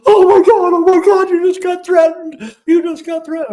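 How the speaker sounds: imitating crying ex-wife. Ex-wife crying after the threat, high tearful wail. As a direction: mimic the ex-wife sobbing in panic. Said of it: "she was in tears".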